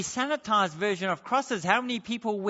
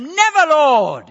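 NEVER shouted high and drawn out; loud, high, emphatic protest on NEVER; emphatic rebuke; 6 semitones higher, 12 dB louder, slower than usual. Acted. Peter rebuking Jesus as he takes him aside, emphatic shout. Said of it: "why I'm emphasizing it".

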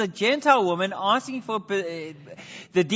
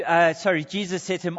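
usual speaking voice

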